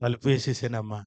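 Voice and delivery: usual speaking voice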